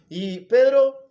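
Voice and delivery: loud, high, ringing proclamation; preacher's climactic cry; full-force preaching. Real, not acted. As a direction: proclaim it to the whole congregation at full voice.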